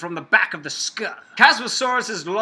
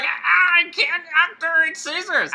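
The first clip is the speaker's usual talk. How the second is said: high, helpless mock struggle; flailing infomercial guy; 9 semitones higher than usual. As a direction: play the clumsy guy in the ad who can't cut. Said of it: "He's like".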